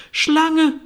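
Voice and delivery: high, loud shriek of alarm; pigeon's screech. A narrator's reading. Pigeon flies at the long-necked intruder, screeching. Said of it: "kreischt die Taube".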